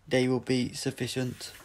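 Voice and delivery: loud, flat robotic commands; a machine giving cold orders; Cyber leader. Acted. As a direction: metallic voice ordering the troops.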